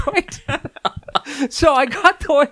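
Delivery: high, laughing through the words. Real, not acted. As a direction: laugh as you tell it. And I'm laughing.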